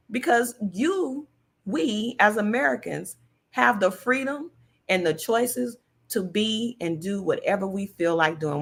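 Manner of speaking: ordinary talk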